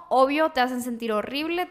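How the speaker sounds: ordinary delivery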